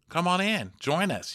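bright high invitation; sing-song app prompt; notification voice. Retold or imitated.

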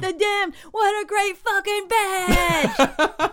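high, drawn-out imitation yell; drunken mock yell. Trashed drummer yelling as he's pulled off stage, mock yell. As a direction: holler like a trashed fan, not at full volume. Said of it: "He's yelling like".

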